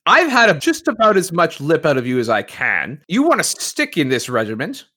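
angry dressing-down; upset officer. Acted. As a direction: lay down the law to an unruly recruit. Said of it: "starting to get a little upset".

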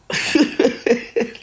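loud burst of breathy laughter. Real, not acted. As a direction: crack up hard.

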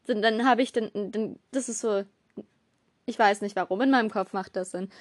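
ordinary talk, nothing marked

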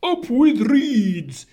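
slow, high, loud hoot; hooting duck-billed dinosaurs. A narrator's reading. Duck-billed dinosaurs cheering for reeds, high hooting. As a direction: hoot it high, drawing the words out. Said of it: "and they hooted".

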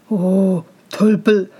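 put-on weary, disdainful older-brother voice; scornful brothers. A narrator's reading. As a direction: sigh at the fool, lightly in character.

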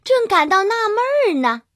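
ordinary delivery

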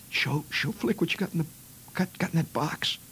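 put-on high, unsteady kid's voice; mimicked shaky kid. Nervous friend urging them to show the box, high and shaky. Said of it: "he says".

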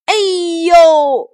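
loud, high "ouch" cry; drawn-out mock cry of pain; acted yelp of pain. Monkey hero struck by the bracelet, loud acted yelp.

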